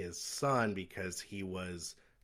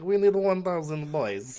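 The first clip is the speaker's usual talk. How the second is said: a lightly voiced movie henchman, louder and higher; 7 semitones higher, 8 dB louder than usual. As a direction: ham up a villain's sidekick barking orders. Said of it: "where he's like".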